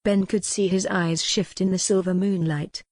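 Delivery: plain reading voice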